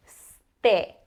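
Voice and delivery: "Ste" with short e, not stay; e as in end. Shown as a demonstration. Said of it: "it is e like the e in end".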